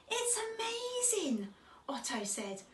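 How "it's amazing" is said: high, slow, breath-light awe; whispery, drawn-out amazement; amazed wonder. A narrator's reading. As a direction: marvel in a hushed little-cub voice. Otto marvels at the pearl.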